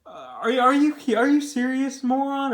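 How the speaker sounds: lightly acted disbelief; incredulous. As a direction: retell a scornful, stammered challenge. Retelling a character's scornful rebuke.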